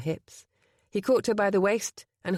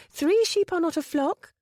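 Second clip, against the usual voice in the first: Penny voice; 11 semitones higher, 3 dB louder than usual. Acted. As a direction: correct him primly. Penny insists.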